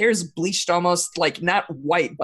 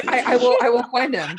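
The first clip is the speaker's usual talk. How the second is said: stammered, higher-pitched promise; anxious underling; 5 semitones higher, 3 dB louder than usual. Acted. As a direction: trip over the words, eager to placate. Underling pleads with his feared lord.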